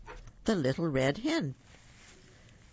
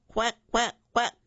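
louder, higher duck quacking; excited duck quack; 7 semitones higher, 5 dB louder than usual. Acted. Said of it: "quacked the duck".

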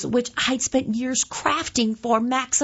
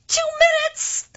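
squeaked, much higher; 3 dB louder than usual. Acted. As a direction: squeak it out in panic. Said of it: "I squeaked".

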